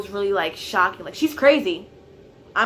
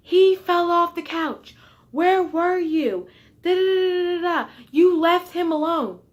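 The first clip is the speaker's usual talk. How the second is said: yelling mom; 9 semitones higher, 7 dB louder than usual. Retold or imitated. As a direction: blame them as the frantic mom. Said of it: "She's like".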